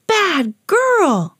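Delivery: drawn-out, high-pitched telling-off; storybook shout. A narrator's reading. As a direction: scold a naughty dog in a high voice. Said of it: "she shouted".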